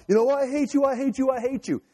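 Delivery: high, mock childish outburst; hammed-up fit of temper, high-pitched; temper tantrum. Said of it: "throwing a little temper tantrum".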